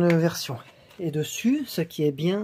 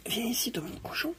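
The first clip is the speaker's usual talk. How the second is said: coaxing pet talk; 7 semitones higher, 9 dB quieter than usual. Acted. Coaxing the machine part.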